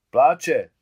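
put-on loud boyish chorus answer; chorus shout. A narrator's reading. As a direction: shout it as one voice. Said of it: "odpověděli mu všichni kluci jedním hlasem".

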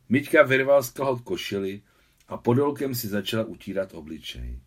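plain reading voice

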